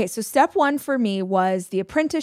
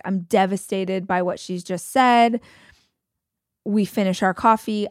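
usual speaking voice

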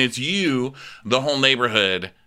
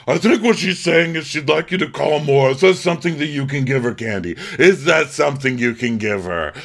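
high, loud mock insistence; Marlo; 6 semitones higher, 4 dB louder than usual. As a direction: press the point in a put-on raised voice. Mimics Marlo pressing Candy.

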